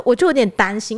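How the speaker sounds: usual speaking voice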